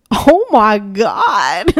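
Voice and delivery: drawn-out, half-laughing wail; mock tearful. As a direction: wail it out as if overcome, laughing at yourself.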